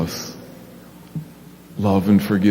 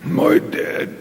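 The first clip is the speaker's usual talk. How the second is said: whispered little puppet grumble; a sulky puppet, voiceless. Acted. Puppet Big Al telling the pastor he's mad, whispered.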